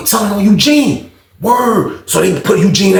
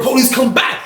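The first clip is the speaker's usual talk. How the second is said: normal talking voice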